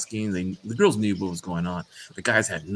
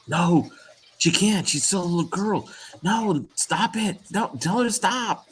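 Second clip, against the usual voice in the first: high, pleading mock panic; freaking out; 10 semitones higher than usual. Dad hears his daughter's first period news, high panicked protest. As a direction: protest like a dad who can't accept it, pitched up. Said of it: "I went like".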